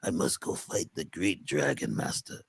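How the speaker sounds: deep, soft hammed-up hero voice; mock heroic warrior. Teller mocking the hero leaving home, deep hushed voice. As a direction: intone it like a solemn fairy-tale warrior.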